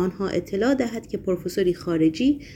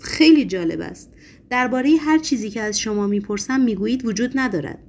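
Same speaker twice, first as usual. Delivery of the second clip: usual speaking voice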